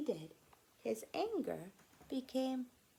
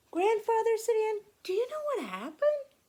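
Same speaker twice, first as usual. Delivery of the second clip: put-on much higher, louder child voice; excited Anne; 13 semitones higher, 10 dB louder than usual. Anne eagerly asking Grandfather, high and loud.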